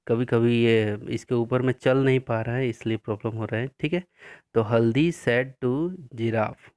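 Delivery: normal talking voice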